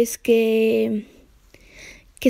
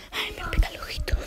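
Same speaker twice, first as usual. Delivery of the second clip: quick, higher whisper; 6 semitones higher, 10 dB quieter, faster than usual. Real, not acted. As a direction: whisper the little complaint quickly. Whispered complaint.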